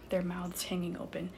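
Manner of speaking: plain reading voice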